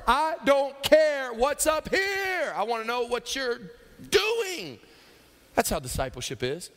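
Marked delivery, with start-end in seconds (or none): 0.1-4.8 high, loud, drawn-out shout; emphatic rebuttal. Acted. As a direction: hammer it home slowly at full voice. Acts patient's emphatic final rebuttal.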